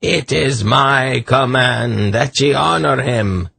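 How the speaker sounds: high, slow madman's decree; shrill command. A narrator's reading. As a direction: order them in a high, drawn-out voice. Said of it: "declaimed in his shrill voice".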